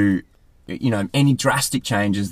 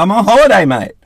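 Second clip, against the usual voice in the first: higher and louder, beaming holiday cheer; cheery reply; 9 semitones higher, 7 dB louder than usual. Retold or imitated. Answering housemates in the UK, bright mock cheer. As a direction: announce it with delight. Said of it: "I'm like".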